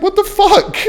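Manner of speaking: high, incredulous squeal; shocked disbelief. Retold or imitated. Reacting to the woman refusing to lend a pen, stunned. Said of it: "I was like".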